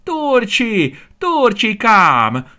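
high, loud, drawn-out impatient call. A narrator's reading. Aranka néni calling her piglet, high, loud and impatient. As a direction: shout the name across the farm. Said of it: "kiáltozta egyre türelmetlenebbül".

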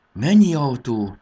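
high, loud, drawn-out wonder; marvelling exclamation; amazed. A narrator's reading. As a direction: stretch it out in awe. Amazed at many cars.

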